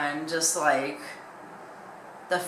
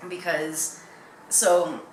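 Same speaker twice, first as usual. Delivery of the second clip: normal talking voice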